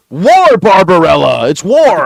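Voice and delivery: drawn-out theatrical shout; mock bellow. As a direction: proclaim it with hammed-up alarm. Mock correction of Defense to War.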